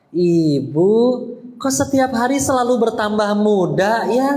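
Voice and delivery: butter her up with obviously false admiration; fake flattery. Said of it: "Nih contoh yang palsu ya".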